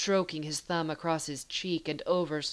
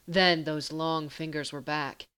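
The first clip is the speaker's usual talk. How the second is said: plain reading voice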